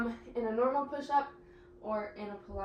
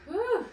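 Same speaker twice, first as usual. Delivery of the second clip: winded whew; 11 semitones higher, 6 dB louder than usual. Real, not acted. Effortful exhale during core set.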